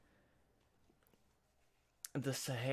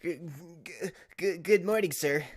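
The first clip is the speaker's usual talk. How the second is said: put-on higher, louder stammering young lawyer; nervous Apollo; 6 semitones higher, 4 dB louder than usual. Streamer voicing Apollo greeting his boss, stammering nervously. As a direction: stutter out the greeting, jittery and eager. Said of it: "I don't know what I'm gonna do for Apollo's voice".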